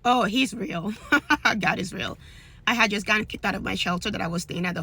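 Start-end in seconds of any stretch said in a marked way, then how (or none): 1.0-1.5 bright burst of laughter; short laugh. Real, not acted. Answering the interviewer, a quick amused laugh. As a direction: laugh it off warmly.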